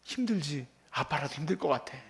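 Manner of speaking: a father soothing his tired son; tender comfort. Retold or imitated. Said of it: "이러면 안 되고". (아빠가 다정하게 위로하듯 부드럽게)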